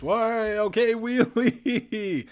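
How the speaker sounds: loud, high, slow yelp; startled laugh. Real, not acted. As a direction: shriek as it tips up. Vehicle pops a wheelie.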